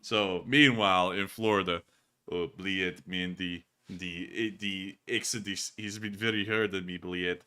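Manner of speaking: lightly voiced Putin, Russian accent; mumbling Russian bathroom attendant. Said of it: "hell of an improv skit".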